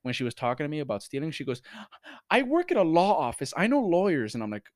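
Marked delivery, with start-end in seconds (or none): 2.3-4.3 higher, louder mocking lady; mock entitled lady. Valet mocking the customer bragging about lawyers. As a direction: parody her smug threat.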